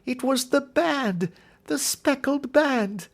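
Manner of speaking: high, slow, strained cry of a dying woman; a dying sister's high, slow, terrified cry; dying cry of terror. Acted. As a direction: gasp out the last words in horror.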